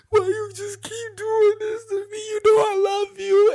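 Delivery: high, loud, wailing through tears; imitated sobbing plea. To his girlfriend, begging her to stop cheating, sobbing high and loud. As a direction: cry it out like a heartbroken begging lover. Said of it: "I used to cry".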